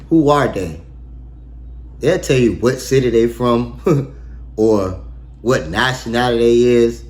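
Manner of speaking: normal talking voice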